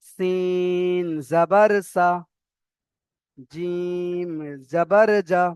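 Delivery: slow, held "সিন" and "জিম"; spelling demonstration. Shown as a demonstration. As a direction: stretch each letter name before "জাবার". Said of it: "তারপরে দেখেন".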